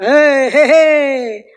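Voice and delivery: loud sharp call to get the helper's attention. Real, not acted. Preacher calling loudly to her helper at the pulpit. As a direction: call out to stop the water spilling.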